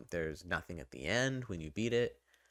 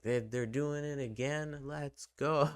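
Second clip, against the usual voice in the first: higher, reliving the hype of the trailer; hyped; 8 semitones higher than usual. Retold or imitated. Fan recalls seeing the trailer at E three, retold excited cheer. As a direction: retell the excited cheer, moderately pumped. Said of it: "this, I was like".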